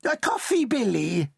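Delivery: put-on high old lady voice talking over; cutting-in grandmother. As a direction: cut her off abruptly. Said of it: "interrupted Mighty Mama".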